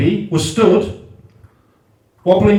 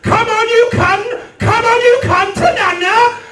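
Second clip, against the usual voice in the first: sing-song coaxing chant climbing higher on every repeat; coaxing singsong; 11 semitones higher than usual. Retold or imitated. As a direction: coax like cheering a toddler on, pitch rising each time. Coaxing someone to push.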